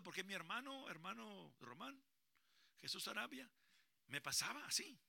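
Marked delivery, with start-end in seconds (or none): none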